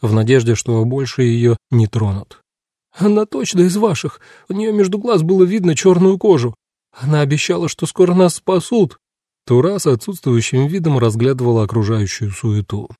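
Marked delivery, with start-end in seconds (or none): 2.9-9.0 high, plaintive informer's voice; acted tearful report, pitched up; sobbing. A narrator's reading. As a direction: whimper it out as a frightened captive. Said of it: "всхлипывая, поведала Карен".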